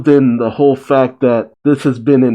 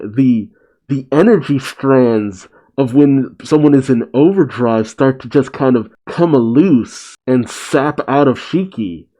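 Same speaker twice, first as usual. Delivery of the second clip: ordinary talk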